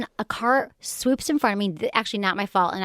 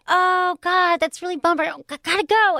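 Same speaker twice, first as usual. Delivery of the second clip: higher, louder mock-flustered mom; mom brushing her off; 10 semitones higher, 5 dB louder than usual. Imitating her mom cutting the call short with a flustered brush-off. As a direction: rush off the phone in a hammed-up panic.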